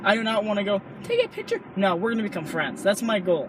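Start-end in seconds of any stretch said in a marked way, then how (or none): 1.0-1.6 very high-pitched little fan plea; tiny high-pitched imitation of an eager fan; mock starstruck fan. Mocks asking a hero for photo.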